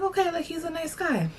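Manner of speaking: high, soft polite concession; mock-sweet reluctant approval; grudging okay. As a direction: lift it up high, softly unconvinced. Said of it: "I'm like".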